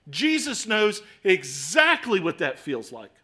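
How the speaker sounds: high and loud, rising sermon climax; preaching at full force. Acted. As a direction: drive it home again and again. Climactic exhortation after the prayer.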